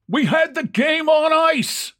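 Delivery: high-pitched fan's shout; crying out. A narrator's reading. Angry fan to a fielder who failed, crying out. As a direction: cry it out high above the crowd. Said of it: "one cried".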